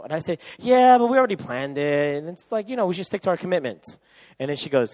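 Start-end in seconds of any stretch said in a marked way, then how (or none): 0.6-4.0 defensive insistence. Retold or imitated. Boyfriend arguing for the planned outing.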